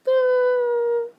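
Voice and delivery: loud, high, shaky sigh of emotion; big emotional sigh. Real, not acted. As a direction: let out an overwhelmed sigh. Moved by the passage.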